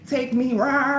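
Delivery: ordinary talk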